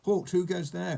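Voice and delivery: high, loud challenge; sentry's cry. A narrator's reading. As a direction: cry out like a guard at the gate. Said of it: "cried the goblin sentry".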